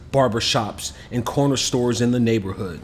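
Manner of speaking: ordinary delivery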